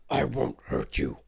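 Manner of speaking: calm her as a giant; beast reassuring. Acted. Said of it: "said the beast".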